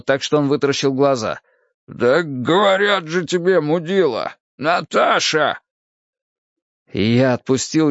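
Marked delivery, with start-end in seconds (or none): 1.9-5.6 slow, higher, dazed exasperation; slurred, annoyed protest; irritated insistence. Acted. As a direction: drag it out, fed up at being asked. Slapped man irritably repeats the password.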